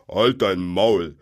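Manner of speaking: loud, drawn-out troll growl; troll's angry shut-up. A narrator's reading. Bill to his mate after choking, loud and irritated. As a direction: bellow it slowly as an irritated troll.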